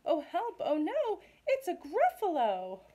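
high, loud cry for help; acted panic. Mouse cries out on seeing the Gruffalo, acted panic.

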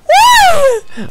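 very high, piercing shouted whoop; loud whoop. Real, not acted. Streamer fumbling in the game, high shouted whoop. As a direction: whoop at full volume, high up.

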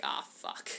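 low, soft groan of defeat; muttered curse. Retold or imitated. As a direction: mutter it as the plan fails. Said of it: "they were like".

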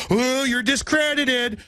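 mocking sneer. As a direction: drag it out like a smug critic. Mocking critics' dismissal.

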